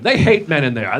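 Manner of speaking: ordinary delivery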